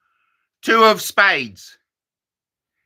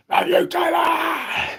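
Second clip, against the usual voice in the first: high, slow fan shriek; mock fan scream; 11 semitones higher, 3 dB quieter than usual. Comic as a fan screaming love at Taylor.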